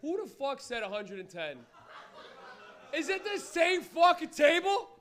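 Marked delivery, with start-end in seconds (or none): 2.9-4.9 high, loud, slow disbelief; punched exasperated yell; incredulous shout. Real, not acted. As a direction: shout it in mock outrage, word by word. Mock outrage at winning table.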